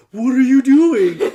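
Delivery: loud, slow, drawn-out disbelief; incredulous yell. Retold or imitated. Gamer yelling at his teammate who jumped into the open, incredulous. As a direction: yell it like you can't believe her move.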